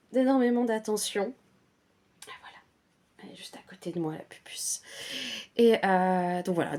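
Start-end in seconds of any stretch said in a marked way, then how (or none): 2.3-4.8 hushed whisper so as not to disturb; whispered aside. Real, not acted. Telling viewers her cat is beside her, whispered. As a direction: drop to a whisper about the cat.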